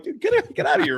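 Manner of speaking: high-pitched mock indignation; retold yelling. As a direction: re-enact chasing off a trespasser. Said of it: "when I was yelling at her".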